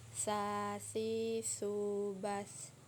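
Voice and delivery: "Sa, si, su, bas" with a soft th as in "think", breath flowing; long vowels on "Sa, si, su", tongue tip at the upper teeth; soft flowing tha. Shown as a demonstration. Teacher shows the letter tha, "Sa, si, su, bas" with soft airflow. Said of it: "Ayunkan suaranya".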